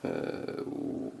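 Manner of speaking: muttering off-mic. Real, not acted. Unsure mid-recording, speaking off-mic.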